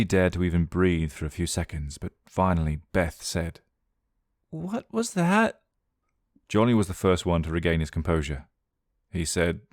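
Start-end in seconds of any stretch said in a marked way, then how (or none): 4.5-5.6 high, slow, awed whisper of fear; frightened gasp. Acted. Beth asking the others, high and frightened. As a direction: breathe it out, stunned by what she saw.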